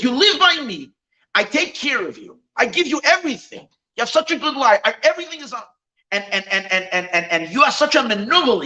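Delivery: high-pitched aggrieved tirade at normal volume; mock yelling. Betrayed boss berates his live-in teacher, mock yelling. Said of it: "He starts yelling at the teacher".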